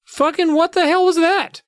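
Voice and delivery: very high-pitched disbelief; incredulous outburst. Real, not acted. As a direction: exclaim it in a high, baffled voice. Host's own disbelief at the scene.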